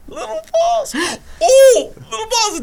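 very high, squealing with laughter; giggly high voice. Retold or imitated. Ned still laughing at his joke, high squeals. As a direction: crack up at your own joke. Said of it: "He's just like".

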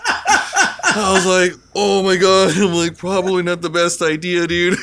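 can barely get it out for laughing. Real, not acted. Friend laughs while recalling his horror at the border.

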